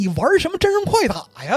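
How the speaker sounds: high, mocking retort; mocking jab. Real, not acted. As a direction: scoff at him for holding back. Mocking Raiden's useless power. (高声嘲讽)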